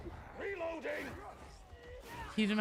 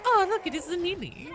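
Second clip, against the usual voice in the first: cat talk voice; 5 semitones higher than usual. Retold or imitated. Owner talking to her cat Neil, higher silly doting voice. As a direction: gush over the big orange cat in a silly French-tinged voice. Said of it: "doing the voice".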